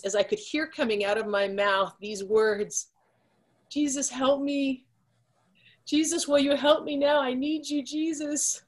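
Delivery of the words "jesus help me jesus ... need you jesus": desperate plea. Retold or imitated. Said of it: "didn't even recognize the sound of my own voice".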